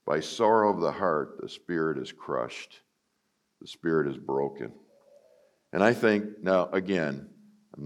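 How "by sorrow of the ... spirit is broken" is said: low, soft solemn reading; solemn scripture reading. Acted. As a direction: let the verse sink in, low and soft.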